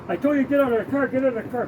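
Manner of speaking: fast, high retold order; imitated yelling guard. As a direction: rattle it off like an irate guard. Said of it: "what are you yelling".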